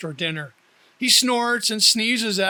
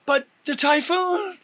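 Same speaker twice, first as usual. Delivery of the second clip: much higher startled question; exclaiming; 11 semitones higher, 3 dB louder than usual. A narrator's reading. Teddy startled by Dee's plan, much higher exclaim. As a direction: catch him off guard. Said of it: "he exclaims".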